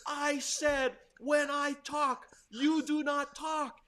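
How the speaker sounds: high, loud, slow mock teacher's yell; retold yelling. Teacher yelling at a boy at the chalkboard, retold yell. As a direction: yell each phrase slowly, imitating a furious teacher. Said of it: "he's yelling at the boy saying".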